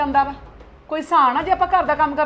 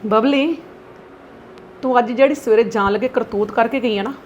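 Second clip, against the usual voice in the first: angry lecturing mother. Acted. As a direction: scold her daughter hard. Mother lectures daughter angrily.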